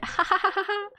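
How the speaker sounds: high breaking laugh; manic laugh. Acted. Siffrin laughing at the pain not working, high and manic. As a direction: laugh a little unhinged.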